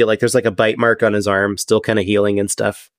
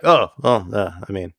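mildly deflated, trailing away in pauses; understated disappointment; crestfallen; 3 semitones lower than usual. Acted. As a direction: give in haltingly, a touch let down. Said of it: "just kinda get kinda crestfallen".

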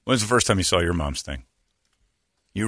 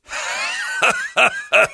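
high cackling laugh. Retold or imitated. Grandma laughing as door closed.

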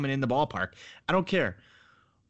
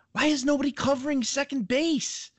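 exasperated rant; 11 semitones higher than usual. Real, not acted. Ranting baseball talk show host.

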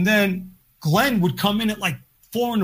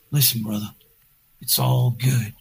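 much lower, reassuring and unhurried; lightly voiced soothing friend, no panic at all; calm Johnny; 6 semitones lower than usual. Imitating Johnny calming everyone down, low and easy. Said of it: "His voice is always calm".

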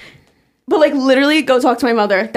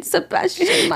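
put-on Sebastian Maniscalco in a New York Italian accent, raised; 6 semitones higher, 5 dB quieter than usual. Showing the epitome of a New York Italian, accent. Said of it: "a New York Italian".

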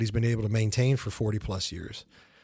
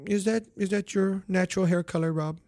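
higher, even-toned boss impression; Doctor Evil-like mimicry, pitched up; Lorne Michaels; 6 semitones higher, 4 dB quieter than usual. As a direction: imitate a nonchalant boss asking out of nowhere. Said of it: "everybody does th-, their Lorne".

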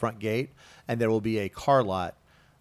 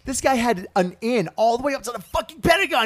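incredulous; 11 semitones higher than usual. Real, not acted. Father incredulous retelling.

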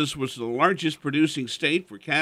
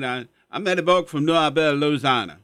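put-on Cajun accent; 4 dB louder, faster than usual. Imitating old friend Eddie's Cajun accent. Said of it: "he still talks like he's a Cajun".

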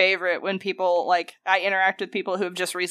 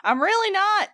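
mock-polite reply pitched way up; high-pitched retort; 11 semitones higher than usual. Worker answering gushing New York customers, high mock politeness. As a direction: act out a high, sing-out denial.

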